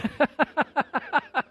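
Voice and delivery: high, loud cackling laugh; real laughter in a long, high run. Real, not acted. Preacher after calling dry-eyed viewers unregenerate, laughing loudly.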